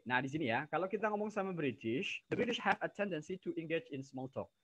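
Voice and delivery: normal talking voice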